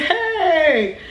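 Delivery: high, stretched "hey" of dread; alarmed exclamation. Retold or imitated. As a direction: cry out in mock panic. Alarmed at mom's words, retold.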